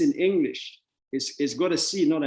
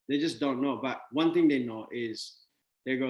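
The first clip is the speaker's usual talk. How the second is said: normal talking voice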